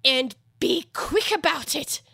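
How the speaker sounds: Hatter voice. A narrator's reading.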